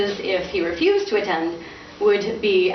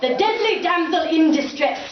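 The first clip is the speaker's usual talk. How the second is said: grand theatrical announcer; 6 semitones higher than usual. Acted. Father proclaiming his play bill theatrically. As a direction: sell the play like a barker.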